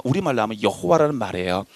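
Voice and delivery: usual speaking voice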